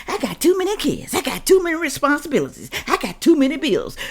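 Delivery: high whiny mimic; mock whiny complainer; mocking excuses. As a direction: parody the excuses in a whine.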